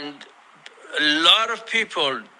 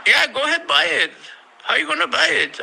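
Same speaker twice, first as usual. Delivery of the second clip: higher, taunting go ahead; mocking challenge; 9 semitones higher, much faster than usual. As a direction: dare him mockingly. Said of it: "Yeah, sure, go buy it".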